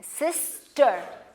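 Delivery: Sister drawn out slowly as the two parts join; blended slowly. Shown as a demonstration. Teacher blending sis and ter for pupils. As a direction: stretch sister as you blend it. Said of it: "अब इन दोनों को combine करें".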